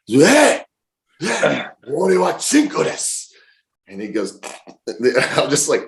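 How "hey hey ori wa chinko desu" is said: high, forceful retold holler; stronger shout. Passenger shouting practised Japanese at taxi driver, high strong holler. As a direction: holler the foreign phrase with more strength. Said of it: "you're more strong, man, more".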